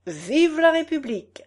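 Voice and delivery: high, declaimed reply; proclaimed campaign slogan; candidate's echo. A narrator's reading. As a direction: echo the crowd's cheer as an orator. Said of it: "Le candidat répondit".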